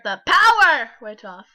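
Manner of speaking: sudden loud burst on POWER; dramatic reading. Shown as a demonstration. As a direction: shout the word power mid-story. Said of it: "gonna read it dramatically".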